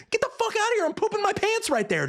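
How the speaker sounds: very high, frantic protest; worked-up exclamation. Acted. Reviewer acting how he'd react to a raptor, frantic. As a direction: blurt it out way up high.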